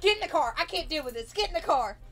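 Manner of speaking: high, loud, fed-up parent's order; yelling. Retold or imitated. Mother ordering daughter into the car, yelling. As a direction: snap it out loud and exasperated. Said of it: "she yells at me".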